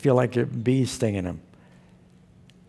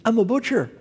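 put-on high-pitched Israeli accent; 10 semitones higher, faster than usual. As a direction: imitate an Israeli man, pitched well up. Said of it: "Israeli accent".

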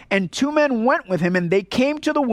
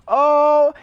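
mock wail; 6 semitones higher, 5 dB louder than usual. Mocking fake repentant husband.

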